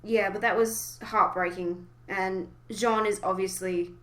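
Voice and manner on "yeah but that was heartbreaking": ordinary talk, nothing marked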